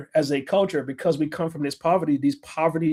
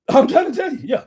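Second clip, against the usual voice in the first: laughing; 9 semitones higher, 6 dB louder than usual. Real, not acted. Laughs at own rant.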